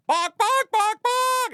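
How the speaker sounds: very high, loud chicken squawking; yelled chicken clucks. Retold or imitated. Crowd yelling in with Chicken Man, loud squawks. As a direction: squawk out a hen call at full voice. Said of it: "start yelling in with me".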